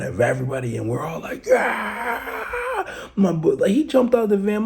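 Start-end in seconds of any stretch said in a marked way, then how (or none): 1.5-3.1 crowd cry. Retold or imitated. Everyone reacting to the knockout, high crowd cry.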